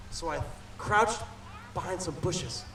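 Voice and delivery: softly, sneaking; hushed. A narrator's reading. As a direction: hush it like you're hiding. Sneaking up in the story.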